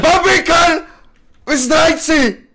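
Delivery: loud, high-pitched furious shout; wściekłe oskarżenie. Acted. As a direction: yell it at the traitors, drawing it out. Oskarża ludzi o zdradę. (wściekły krzyk na zdrajców)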